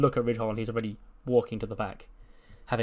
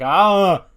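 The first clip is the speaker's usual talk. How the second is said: sudden high shriek of fright; startled yelp; 8 semitones higher, 13 dB louder than usual. Retold or imitated. Mackie startled by the locker room knock, shrieks. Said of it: "And Mackie's like".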